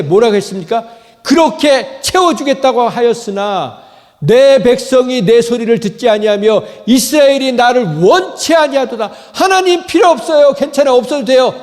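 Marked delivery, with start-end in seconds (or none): 1.2-9.1 loud, high lament; God's lament. Acted. Preacher voicing God's lament. 9.3-11.5 dismissive. Pretend. Mocking people who reject God.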